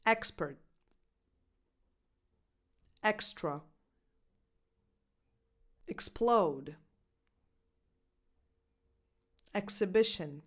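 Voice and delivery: Expert, extra, explode, exhibition each said with a crisp ks. Shown as a demonstration. Coach reads example words with a clear ks. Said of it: "its natural sound".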